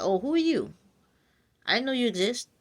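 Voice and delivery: high, surprised auntie voice asking who you are; surprised relative. Retold or imitated. Family member surprised by the new partner, high and startled. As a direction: play a startled family member meeting a stranger.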